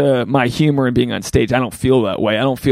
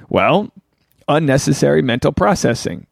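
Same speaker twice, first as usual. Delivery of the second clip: ordinary delivery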